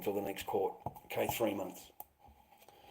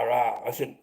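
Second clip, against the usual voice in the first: ordinary talk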